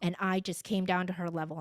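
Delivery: ordinary talk, nothing marked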